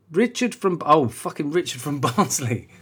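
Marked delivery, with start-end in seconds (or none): 0.1-0.8 higher posh announcing; a touch of posh on the name. Retold or imitated. Review reader naming Richard in a posh voice. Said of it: "This now went posh".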